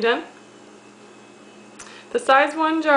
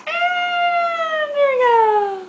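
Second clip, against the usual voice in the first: much higher, slow sing-song spoonful voice; high baby talk; 12 semitones higher, much slower than usual. Acted. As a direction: stretch it out up high like cooing to a baby. Mom feeding baby a spoonful.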